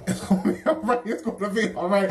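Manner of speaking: laughing through the words. Real, not acted. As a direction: laugh while reassuring yourself. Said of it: "I'm so glad I can laugh right now".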